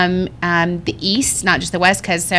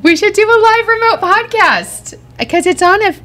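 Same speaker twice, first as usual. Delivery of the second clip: much higher, louder excited pitch; 14 semitones higher, 4 dB louder than usual. Real, not acted. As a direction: burst out with the idea. Excited podcast idea.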